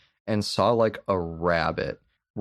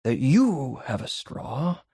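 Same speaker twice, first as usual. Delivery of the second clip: hammed-up Daniel Plainview; 9 semitones higher than usual. Retelling the milkshake speech to his co-host, hammed-up and gloating. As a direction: savour each word like a gloating villain. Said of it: "He goes".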